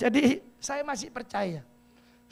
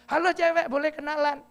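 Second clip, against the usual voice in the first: high, loud flirty catcall; 7 semitones higher, 7 dB louder than usual.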